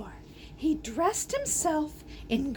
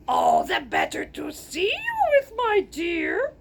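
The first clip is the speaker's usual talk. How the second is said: Grover from Sesame Street; 3 semitones higher than usual. Acted. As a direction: boom it out like a furry muppet. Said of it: "I kinda sound like Grover from Sesame Street".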